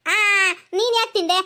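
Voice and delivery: high, slow fake crying; mock wail. Aunt mocking the crying girl, hammed-up fake wail. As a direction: pretend to weep over her mistake. Teasing child, fake wailing.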